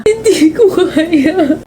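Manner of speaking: slow, high, breaking refusal; tearful. Acted. Grazel tells her friend's ghost she can't let go, tearful. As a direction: say it as if you can't go on.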